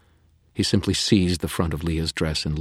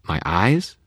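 stammering; 5 semitones higher, 6 dB louder than usual. A narrator's reading. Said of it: "Susan stammered".

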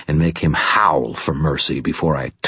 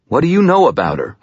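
higher, louder and fast; angry; 11 semitones higher, 5 dB louder, faster than usual. Acted. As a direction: fire it back in fury. Furious demand.

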